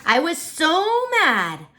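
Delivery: put-on high, slow, furious child voice; a little girl stomping mad; mad kid. Child recalling being furious.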